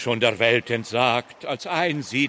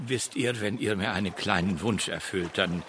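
sly and hushed; 6 semitones lower, 9 dB quieter than usual. Acted. Sly request, voice drops.

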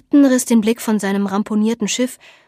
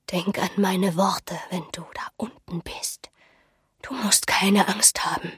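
put-on old woman's breathy parting counsel; mysterious old seer; 6 dB quieter than usual. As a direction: hush it right down, eerie and soothing.

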